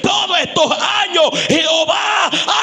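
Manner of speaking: loud, slow, high shouted testimony; shouted prayer to God becoming sermon climax; preaching at full force. Acted. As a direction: pour it out over the congregation.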